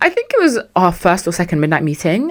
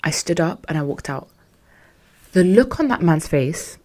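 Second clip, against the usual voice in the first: normal talking voice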